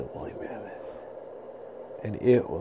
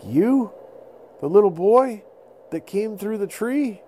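high, louder amazed voice; amazed mammoth; 11 semitones higher, 5 dB louder than usual. Acted. Mammoth amazed to meet the boy. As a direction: gasp it in wonder.

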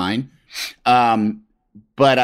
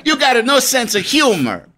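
higher, mock offended Italian chef; fake Italian accent; 10 semitones higher than usual. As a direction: huff it like a touchy cartoon chef. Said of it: "fake Italian accent".

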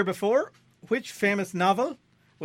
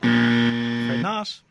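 mock solemn; 5 semitones lower, 7 dB louder, much slower than usual. Quizmaster slowly denies answer.

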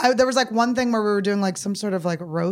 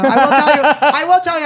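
loud playful insistence; 7 semitones higher, 11 dB louder than usual. Real, not acted. Loud joking repeat.

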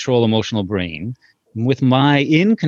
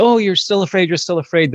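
soothing empathy; 8 semitones higher than usual. Retold or imitated. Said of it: "And so I said".

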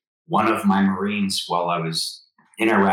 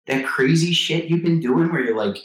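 higher, mock-incredulous wife voice; 7 semitones higher than usual. Wife teasing her husband about his crazy workouts, in a higher mock-incredulous voice. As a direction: play the wife, half teasing and disbelieving. Wife reacting to seminar news.

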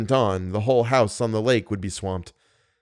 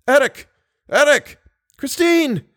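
very high, loud cries; desperate shouting; 5 dB louder, slower than usual. A narrator's reading. Captives in dark water call out, desperate shout. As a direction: shout names desperately for help. Drowning men call for help.

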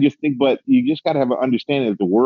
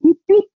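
high, loud mock police siren blip; siren imitation; 5 dB louder than usual. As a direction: chirp it out like a cop's siren.

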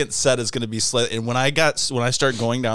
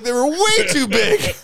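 much higher, louder, half-crying mock shout; Todd freaking out; 5 dB louder than usual. Friend recalling plumber's huge teeth, shrill mock yell. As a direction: wail it like a laughing rant. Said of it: "He's like".

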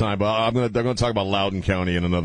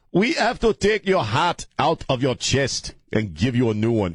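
put-on higher-pitched, slow African-accented doctor; mock African accent; 5 semitones higher than usual. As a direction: deliver a grave verdict in an exaggerated African accent. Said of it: "do a very racist accent".

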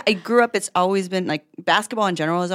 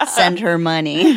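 laughing Alexa reminder; 4 semitones higher, 6 dB louder, slower than usual. Pretend. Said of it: "send mine to my mom".

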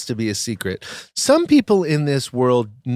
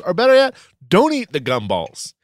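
high, punched comic scolding; mock-outraged rant; 4 semitones higher than usual. Co-host telling off the picky yacht guest, mock outrage. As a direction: hammer the key words in exasperated mock fury.

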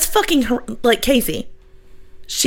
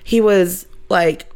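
ordinary talk

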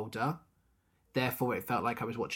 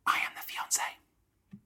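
whispering. Real, not acted. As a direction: whisper it like a secret. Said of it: "Why are you whispering?".

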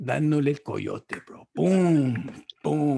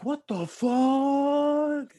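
high, very slow drawn-out mock outrage; guy caught in bed; 9 semitones higher, much slower than usual. The man caught with his girlfriend, stunned mock outrage. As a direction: stretch it out, stunned and offended. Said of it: "then the guy's like".

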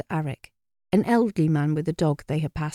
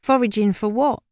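higher-pitched, doubting query; sceptical question; 5 semitones higher, 4 dB louder than usual. A narrator's reading. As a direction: question it, not quite convinced. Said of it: "looked at her in the sceptical way".

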